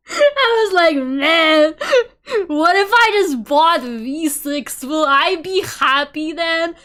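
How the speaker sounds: high, loud, slow and laughing; laughing through words. Real, not acted. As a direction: speak through the giggles. Retold wish while laughing.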